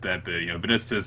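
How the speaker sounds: normal talking voice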